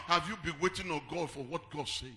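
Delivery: loud, rising sermon voice over music; full-force preaching. Acted. Pastor preaching to the congregation, fervent and loud.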